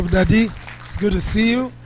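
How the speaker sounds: usual speaking voice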